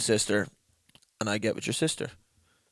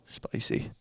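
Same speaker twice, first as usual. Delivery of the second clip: whispered; 8 semitones lower, 4 dB quieter, much slower than usual. Real, not acted. Reacting to juicy question.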